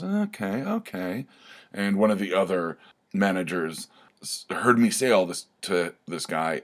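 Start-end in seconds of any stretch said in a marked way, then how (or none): 0.0-1.3 much higher, tentative "okay" as it clicks; dawning realization. Retold or imitated. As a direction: imitate someone slowly getting it, pitched high. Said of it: "there was a light bulb that kinda went off".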